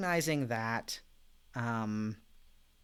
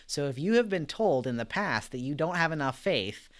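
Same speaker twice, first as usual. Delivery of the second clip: ordinary talk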